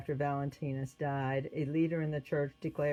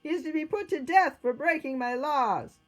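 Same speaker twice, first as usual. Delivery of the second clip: very high, loud imperial shout; furious-sounding ringing command; crying out a sentence; 12 semitones higher, 7 dB louder than usual. A narrator's reading. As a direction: condemn the prisoner at full voice. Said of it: "changed his mind and cried".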